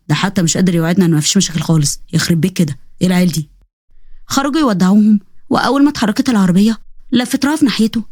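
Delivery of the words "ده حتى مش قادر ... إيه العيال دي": lower, weary parent's grumble; a hint of a fed-up dad; grumbling father. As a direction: shake your head at the kids.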